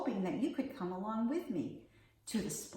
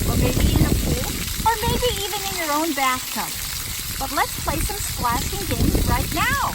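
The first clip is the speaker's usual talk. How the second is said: upbeat, fast, louder host voice; lively kids' show host; 8 semitones higher, 12 dB louder than usual. Acted. Kids' host inviting children to splash, bright and quick. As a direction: bounce it along for the kids. Splash pad outdoor video.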